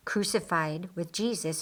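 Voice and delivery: ordinary delivery, nothing marked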